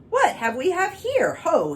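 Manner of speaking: high, loud royal demand; demanding king. Acted. King spotting the dancing cow, demanding loudly. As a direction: bark it down from the throne, high and loud. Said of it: "he demanded".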